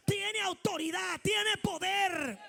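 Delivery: loud, slow shouted climax; full-force preaching. Acted. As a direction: drag out each word in triumph.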